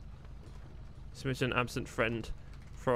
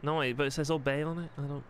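put-on light Scouse accent as the character Joel; easygoing Liverpool lad in a Scouse voice; 4 dB quieter than usual. Joel chatting with Cyrus, relaxed Scouse accent.

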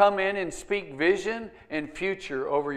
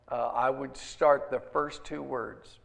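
ordinary talk

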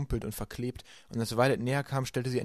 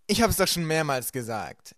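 impatient villain, higher and louder; Stefano retorting; 5 semitones higher, 6 dB louder than usual. A narrator's reading. Stefano answers Mister Poe, impatient and pushy. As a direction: brush the objection aside. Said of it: "entgegnete Stefano".

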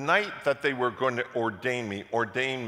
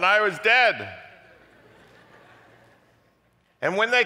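loud, high climactic sermon delivery; full-force preaching; 12 semitones higher, 9 dB louder than usual. Acted. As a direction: build it up and thunder to the back row.

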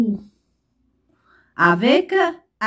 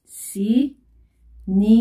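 "Cinéma" very slowly, split into ci, né, ma; syllable by syllable; much slower than usual. Shown as a demonstration. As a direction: draw out "Cinéma" with gaps between syllables. Teacher breaks the example word up.

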